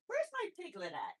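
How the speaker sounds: high, soft sing-song call for the pet; quiet squeaky coo searching for her guinea pig. Real, not acted. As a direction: coax the piglet out sweetly.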